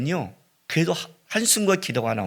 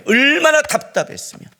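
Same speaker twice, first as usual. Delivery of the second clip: exasperated; 11 semitones higher, 6 dB louder than usual. Acted. Preacher voices Jesus's anguish, building.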